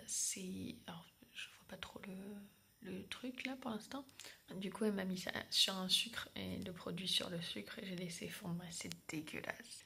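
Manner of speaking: soft hushed whisper. Real, not acted. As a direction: whisper it quietly. Whispering before surgery.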